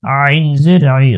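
normal talking voice

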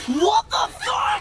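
loud, high, drawn-out shock; stunned what the fuck; shocked shout. Real, not acted. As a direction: blurt it out in real disbelief. Shocked outburst at surprise.

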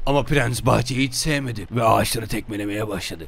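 ordinary talk, nothing marked

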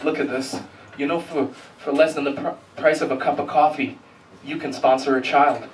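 low, soft, solemn charity appeal; mock somber appeal for donations. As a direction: imitate a grave charity ad narrator, hushed and low. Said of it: "he's like".

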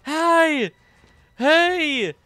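high-pitched cooing hello; delighted, high squeaky greeting; gushing squeal. Real, not acted. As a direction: squeal it at something adorable. Gushing greeting to a cute character.

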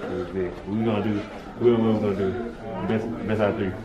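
ordinary delivery

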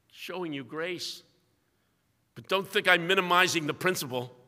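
ordinary talk, nothing marked

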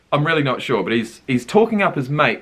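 ordinary delivery

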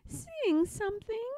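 high, pushy little voice; demanding. Acted. Pigwig demands a song from Pigling, high and pushy. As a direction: insist like a spoiled piglet. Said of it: "she demanded".

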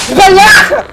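loud, sudden startled curse; startled shout. Real, not acted. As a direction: yell out in shock. Jump scare in game.

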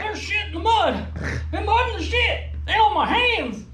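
high, disgusted exclaiming; high-pitched grossed-out complaint. Real, not acted. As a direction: yelp about the mess. Disgusted after mud ride.

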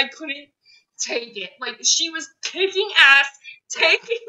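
ordinary delivery

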